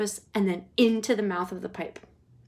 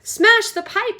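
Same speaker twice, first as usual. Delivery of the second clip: very high, loud yell; mother yelling very high; 12 semitones higher, 9 dB louder, slower than usual. Acted. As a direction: yell an order. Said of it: "yelled Missus Gloop".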